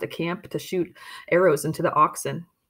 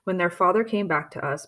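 ordinary delivery, nothing marked

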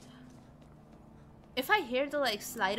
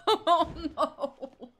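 laughing "oh no", higher; 6 semitones higher, 6 dB louder than usual. Real, not acted. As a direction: laugh it out in dismay. Laughing at surprise.

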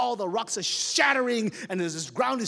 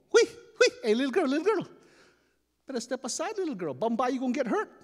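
Ahua calling flirty; 6 semitones higher, 3 dB louder than usual. Acted.

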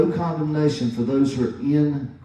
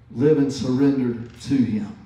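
usual speaking voice

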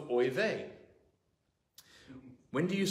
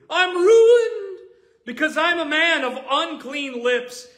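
much higher, much louder wailing lament; anguished cry of Isaiah; 13 semitones higher, 11 dB louder than usual. Acted. Isaiah crying out in terror before the Lord, loud wailing lament. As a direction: cry out as a ruined man before God.

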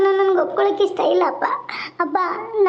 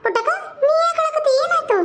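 much higher sister voice, anxious; high, concerned older sister voice; worried elder sister; 9 semitones higher than usual. Acted. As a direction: rush in asking the crying girl what happened. Elder sister asks why crying.

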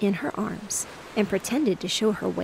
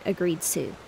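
ordinary delivery

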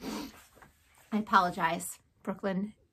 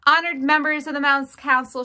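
loud, raised formal proclamation; shouted address; 4 semitones higher, 7 dB louder than usual. A narrator's reading. Furlow addressing the Mouse Council, shouting. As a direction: hail the council across the hall. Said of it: "shouted Furlow".

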